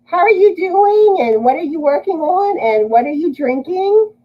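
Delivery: high, upbeat questions to a friend; excited greeting. Real, not acted. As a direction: ask brightly, glad she's here. Greeting a viewer on live.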